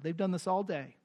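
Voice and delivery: ordinary delivery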